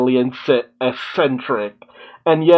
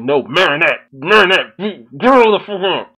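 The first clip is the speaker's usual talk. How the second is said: exasperated mock scolding; 3 semitones higher than usual. Yelling at character on screen.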